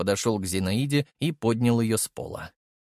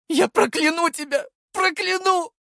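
fading curses; 3 dB louder than usual. A narrator's reading. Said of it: "Голос становился всё тише, терялся в коридорах".

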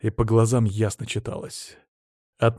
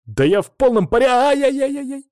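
loud, high protest breaking into a pained wail; protest into yelp; 6 dB louder than usual. A narrator's reading. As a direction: leap in eagerly, then howl in pain. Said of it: "тут же поморщился и плюхнулся обратно". (громкий протест, переходящий в вопль боли)

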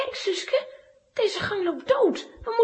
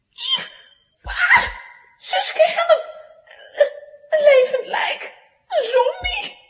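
shrieking in panic, high and loud; high panicked yells, half laughing; terrified screaming; 8 semitones higher, 8 dB louder, slower than usual. Acted. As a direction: scream for help at the walking corpse. Girl screams at a corpse.

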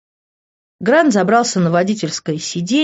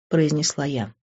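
usual speaking voice

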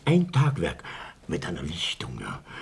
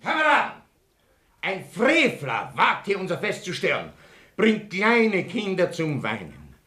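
pompous speech; 8 semitones higher than usual. Acted. Pompous rally speech to members.

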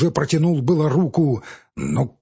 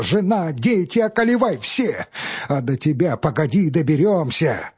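rising higher into a near-cry; desperate outburst; 5 semitones higher than usual. Acted. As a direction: despair climbing into a wail.

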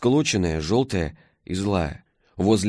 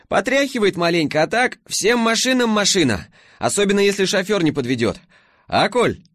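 перекрикивая мотор; 11 semitones higher, 4 dB louder than usual. A narrator's reading.

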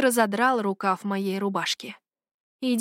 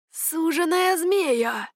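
slow, much higher amazed exclamation; surprised; 8 semitones higher, much slower than usual. A narrator's reading. Warrior recognising the dragon's bride, amazed. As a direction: gasp it out in astonishment. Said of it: "удивлённо сказал один из них".

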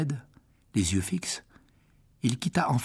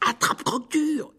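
shouted. Acted. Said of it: "hurla Harry".

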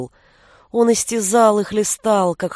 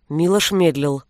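ordinary delivery, nothing marked

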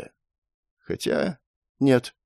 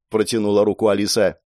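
ordinary delivery, nothing marked

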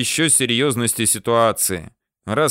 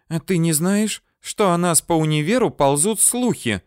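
higher-pitched female character voice, accusing; reproachful complaint; 7 semitones higher than usual. A narrator's reading. Lebedeva confronts him over university rumours, reproachful complaint. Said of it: "предъявила мне претензии Лебедева".

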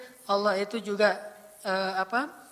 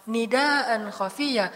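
slow emphasis; much slower than usual. Shown as a demonstration. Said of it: "lirih banget suaranya".

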